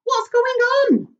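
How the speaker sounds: high, loud and slow confused call; alarmed cry. Acted. James leaping from his hammock, alarmed and crying out. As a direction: call out bewildered.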